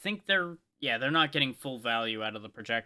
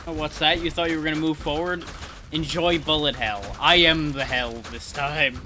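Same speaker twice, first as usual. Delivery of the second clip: louder, gloating over-the-top villain boast; mock villain taunt; 4 semitones higher, 7 dB louder than usual. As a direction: ham it up like a boss taunting the enemy.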